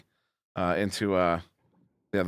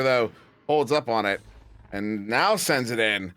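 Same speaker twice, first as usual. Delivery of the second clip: higher, excited live play-by-play; play-by-play commentary; 8 semitones higher, slower than usual. Acted. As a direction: call the action like a sportscaster.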